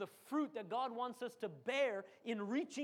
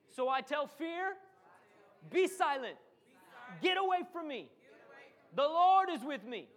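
louder and louder prayer to fear; loud declaration; 3 semitones higher, 6 dB louder than usual. Shown as a demonstration. Preacher leading the church in declaring. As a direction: proclaim it boldly. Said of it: "Somebody tell the Lord".

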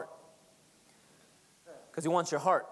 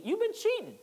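teasing high accusation; mock accusing; 11 semitones higher than usual. Preacher teasing the congregation, mock accusing. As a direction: playfully accuse the congregation, pitch jumping up. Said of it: "I'm messing".

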